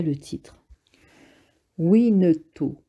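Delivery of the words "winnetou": spelling it out with difficulty; halting, effortful reading; laboured reading. A narrator's reading. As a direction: drag the syllables out like a poor reader. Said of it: "épela-t-il avec difficulté".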